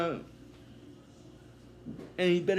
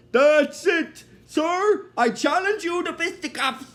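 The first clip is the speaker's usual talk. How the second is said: outraged challenge; 8 semitones higher than usual. Retold or imitated. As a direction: shout it, stiffly offended. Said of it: "he's like".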